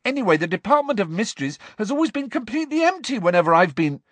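fast, high, insistent rush; heated argument. A narrator's reading. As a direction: argue back heatedly without stopping. Harry keeps shouting, gets cut off.

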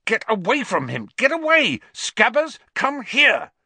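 high, loud, frantic shouts; shouting at the cat. A narrator's reading. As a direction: chase the cat off, yelling. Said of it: "his shouts at Crookshanks".

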